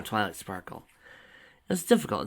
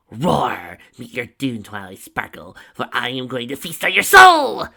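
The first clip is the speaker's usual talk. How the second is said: booming monster threat; 3 semitones higher, 14 dB louder, slower than usual. A narrator's reading. Tracy threatens Twilight with a hammed-up villain roar. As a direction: ham it up like a villain.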